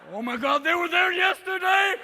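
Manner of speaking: rising to a high, loud mock panic; hammed-up shocked outcry; panicked dog. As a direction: wail in horror as the dog. Dog discovers its balls are gone.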